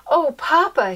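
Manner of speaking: high, loud delighted cry; excited exclaim. Acted. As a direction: gasp it out with delight. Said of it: "she exclaimed".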